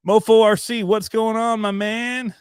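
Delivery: high-pitched, hyped-up shout-out; high, loud-starting hello; excited greeting. Real, not acted. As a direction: greet a buddy with big high energy. Calling out greeting to a viewer.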